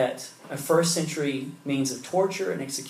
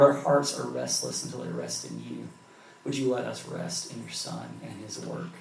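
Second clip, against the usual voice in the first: soft, earnest prayer voice; closing prayer; 4 semitones lower, 6 dB quieter than usual. Acted. The pastor prays over the congregation, soft and sincere. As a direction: pray sincerely, unhurried, for the room.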